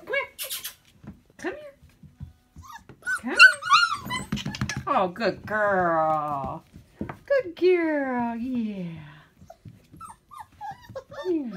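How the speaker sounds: very high drawn-out puppy calling. Real, not acted. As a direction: coax and praise a puppy.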